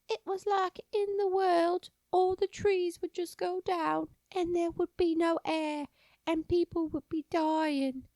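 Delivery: put-on high, slow, wide-eyed little boy. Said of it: "that's exactly what he sounded like".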